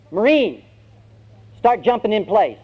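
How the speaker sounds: high, barked military order; drill sergeant. Acted. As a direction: bark a command at a recruit.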